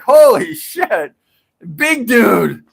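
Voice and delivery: loud, high retold outburst; freaking out. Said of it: "And I'm freaking out".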